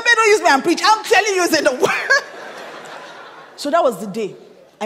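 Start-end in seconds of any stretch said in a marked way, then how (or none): 0.0-2.2 loud, high protest through laughter; laughing protest. Retold or imitated. Wife laughingly protests to husband who preaches at her. 2.3-3.5 breathy laugh. Real, not acted. Laugh after the retold exchange.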